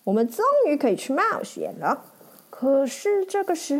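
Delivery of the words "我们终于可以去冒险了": higher, eager little ferret; chirpy sidekick agreeing, rushing the end. Acted. Ferret agrees with the knight about adventure, high and eager. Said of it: "雪貂也说".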